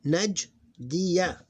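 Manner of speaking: "Najdiya" loud and high in two parts; different voweling. Shown as a demonstration. Teacher showing different voweling, emphatic and split. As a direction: pause after Naj, then a clear "diya". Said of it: "consonants with different voweling".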